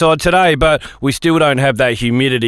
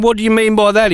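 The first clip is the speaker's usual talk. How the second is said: mock indignant; 8 semitones higher than usual. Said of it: "I went".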